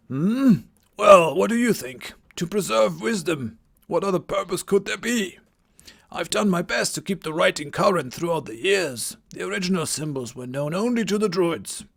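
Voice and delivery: put-on a theatrical old druid greeting; old wizard voice. Game master voicing Merlin greeting the Vikings, old wizard voice. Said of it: "the voice that I'm giving him".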